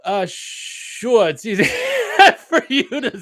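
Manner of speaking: laughing through a put-on New York accent; playful East Coast accent. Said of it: "I'm gonna do my best East Coast accent".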